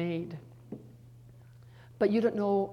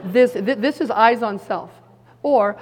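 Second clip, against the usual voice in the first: laughing outburst; 3 semitones higher, 12 dB louder than usual. Real, not acted. Laughs and shouts point.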